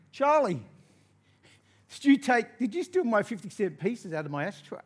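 loud, high accusing shout; accusing big brother. Retold or imitated. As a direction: imitate an angry brother yelling an accusation. Older brother confronts him.

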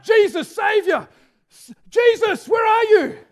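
loud, high cry for Jesus; desperate shout. Acted. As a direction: shout across the crowd like a desperate blind beggar. Blind beggar shouting for Jesus.

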